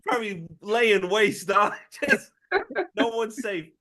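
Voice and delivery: high voice squeezed by laughter; laughing. Real, not acted. Riffing to co-hosts on young Charles at college, laughing. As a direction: struggle to talk while cracking up. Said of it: "I'm just laughing".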